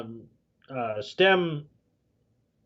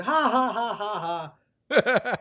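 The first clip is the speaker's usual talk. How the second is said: higher, spaced-out fake "ha ha"; hammed-up cackle in separate bursts; mock villain laugh; 5 semitones higher, much slower than usual. Team Rocket drops the pretence of reforming, mock villain laugh.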